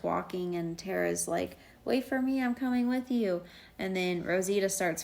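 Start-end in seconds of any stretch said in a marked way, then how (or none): 1.8-3.4 higher-pitched imitated call; retold calling after. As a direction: mimic someone hurrying to join. Acting out Tara calling after Glenn.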